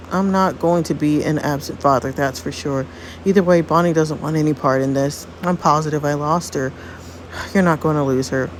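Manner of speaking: usual speaking voice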